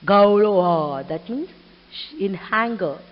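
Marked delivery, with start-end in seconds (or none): 0.0-1.1 loud, drawn-out mock gull screech; angry mocking scream. Mother gull screams back at her chick, angry mock call. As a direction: stretch out an imitated angry bird cry. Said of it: "with angry".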